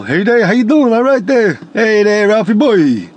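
hammed-up East Coast lug greeting a pal; Norton or Barney Rubble. Shown as a demonstration. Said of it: "sounded like Norton from The Honeymooners, or like Barney Rubble".

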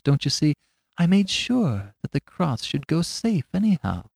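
high, mild and simple; meek little priest. Acted. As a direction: speak like a gentle country priest.

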